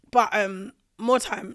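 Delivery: ordinary delivery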